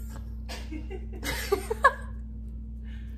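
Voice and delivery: soft airy laugh, unvoiced; breathy giggle. Real, not acted. Breathy laugh after complaining.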